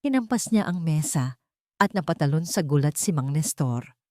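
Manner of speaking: ordinary talk, nothing marked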